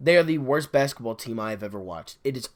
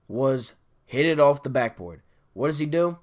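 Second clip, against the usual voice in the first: usual speaking voice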